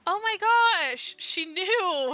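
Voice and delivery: real loud, high squeal of realization; drawn-out, high exclamation of shock; astonished outburst. Real, not acted. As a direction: blurt it out loudly, amazed by the twist. Reader reacts to the story twist.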